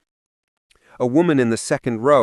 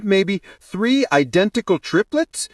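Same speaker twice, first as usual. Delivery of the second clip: unsure guess; 7 semitones higher than usual. A narrator's reading. Bridesmaid offers unsure guesses.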